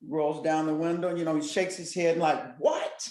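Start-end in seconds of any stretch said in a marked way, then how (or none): 2.6-3.1 high-pitched startled "what", imitated; retold startled reaction. Man at car window reacting to officer, retold as startled. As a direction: imitate someone taken aback, pitch leaping up. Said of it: "George Floyd is startled".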